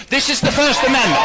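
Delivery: defiant shout. Real, not acted. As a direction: slam the point home to the board. Angry citizen addressing school board.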